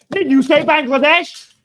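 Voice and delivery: incredulous mock accusation; a hint of a scandalised critic; mock accuser. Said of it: "And even you're like".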